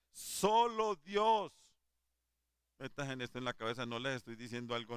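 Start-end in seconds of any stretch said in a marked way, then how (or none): 0.1-1.5 very slow, loud and high, each word stretched; preacher booming the climax to the congregation; slow proclamation. Acted. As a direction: proclaim it like the final verdict.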